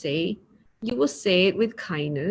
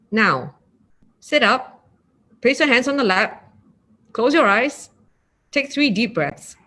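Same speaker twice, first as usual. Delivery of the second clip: louder, curt commands; like an instructor; 3 semitones higher, 4 dB louder than usual. Shown as a demonstration. Meditation teacher mimicking a bossy instructor, louder and curt. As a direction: bark orders at the class. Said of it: "I will sound like an instructor".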